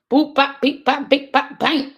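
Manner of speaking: boop-bop like keys being hit; chirpy sound effects while typing; mock beeps. Mimicking typing the answer in.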